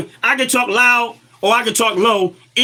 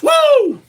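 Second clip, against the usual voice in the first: high woo; 13 semitones higher than usual. Real, not acted. Exclamation about Democrats.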